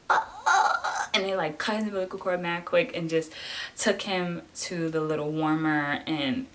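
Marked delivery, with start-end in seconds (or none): 0.1-1.1 put-on high, loud baby wail; acted high-pitched infant cry; imitated newborn cry. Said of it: "all you hear was".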